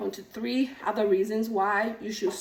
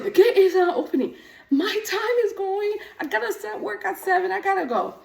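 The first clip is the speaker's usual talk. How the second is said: panicked rush; 8 semitones higher, 5 dB louder than usual. Retold or imitated. Panicked retold self at gate.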